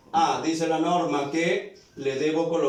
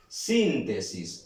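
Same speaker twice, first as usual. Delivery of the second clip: "Síntesis" slow, with long stressed "Sín", then falling; stress on first syllable; 5 semitones lower, slower than usual. Shown as a demonstration. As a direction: lean hard into "Sín" of "Síntesis", drawn out. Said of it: "Suena fuerte aquí". ("Síntesis" lento, marcando la primera sílaba "Sín")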